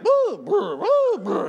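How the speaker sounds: high, loud playful "boo" sounds; imitated carefree child noises. Preacher imitating a little girl coloring, high carefree noises. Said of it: "And she's like".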